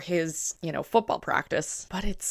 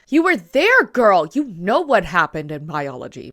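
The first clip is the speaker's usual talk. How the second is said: loud mock retort; 7 dB louder than usual.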